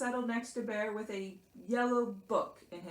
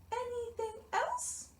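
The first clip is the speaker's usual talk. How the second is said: very high little mouse question; Mouse asking; 4 dB quieter than usual. A narrator's reading. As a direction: inquire meekly as a tiny mouse. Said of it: "asked Mouse".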